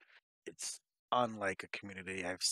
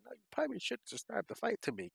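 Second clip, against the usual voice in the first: much higher, pleading thought; mock pleading inner voice; 13 semitones higher than usual. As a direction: squeak it out like a nervous thought. Inner voice, high pitch.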